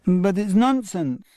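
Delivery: high, slow whining protest; objecting child. Acted. Damien objecting to the game, high and slow. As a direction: protest like a puzzled little boy. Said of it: "objected Damien".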